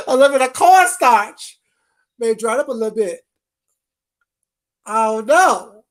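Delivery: high, loud, laughing through the words; loud mocking laugh over cornstarch; mocking laughter. Real, not acted. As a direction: cackle while mocking her. Mocking remedies while laughing.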